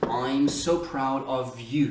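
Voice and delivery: slow, careful "I'm so proud of you."; slow demo. Shown as a demonstration. Teacher slowing down to show the contraction. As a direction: draw out "so" and link "of you". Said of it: "contraction, I'm".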